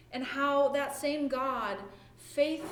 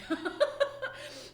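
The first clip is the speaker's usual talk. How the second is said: bright high laugh; 12 semitones higher than usual. Real, not acted. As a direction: burst into a short high laugh. Laughs at own joke.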